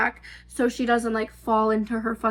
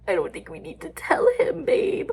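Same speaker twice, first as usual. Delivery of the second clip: whispered refusal. Retold or imitated. Nervous refusal.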